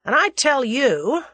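loud, high, slow comeback; forceful American retort, drawn out. A narrator's reading. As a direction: throw it back with weight. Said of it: "retorted Julius".

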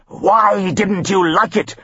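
slow, rasping question; hoarse, strained demand; rasped. A narrator's reading. Wounded-looking father questioning son, rasping. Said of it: "he rasped".

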